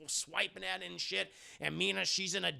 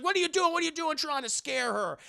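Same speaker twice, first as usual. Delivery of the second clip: higher, agitated demand; mock indignant protest; 9 semitones higher, 4 dB louder than usual. [name] grabbing Van Helsing to protest, mock indignation. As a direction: confront him in alarm.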